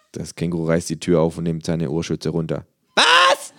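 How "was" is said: high, loud yell; shouted. A narrator's reading. Kangaroo lifting ear muffs, shouted question. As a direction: shout it as if half deaf. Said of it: "schreit es".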